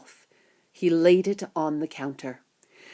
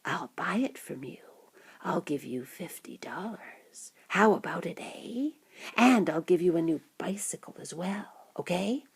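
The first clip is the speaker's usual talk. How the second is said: hushed, coaxing whispered offer; 2 semitones lower, 8 dB quieter than usual. A narrator's reading. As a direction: whisper it secretly as a persuasive man. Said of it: "the man whispered".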